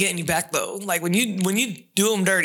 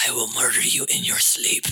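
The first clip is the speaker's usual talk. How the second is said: seething shrieking spirit; 8 semitones lower than usual. Retold or imitated.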